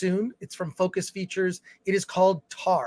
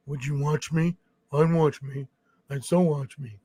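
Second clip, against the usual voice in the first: lower, creepy serial killer voice, badly done; Buffalo Bill voice; 5 semitones lower than usual. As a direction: do a terrible creepy killer purr. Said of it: "That's my terrible Buffalo Bill accent".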